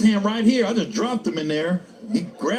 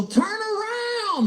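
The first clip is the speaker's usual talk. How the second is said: high, very slow sing-song call; slow, high mock announcer; drawn-out announcer reveal; much slower than usual. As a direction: stretch it out like a big stadium reveal.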